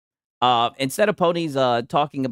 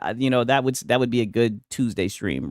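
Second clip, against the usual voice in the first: usual speaking voice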